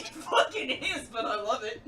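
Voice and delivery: laughing. Real, not acted. Laughing agreement.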